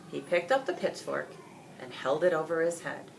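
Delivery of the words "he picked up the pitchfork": ordinary delivery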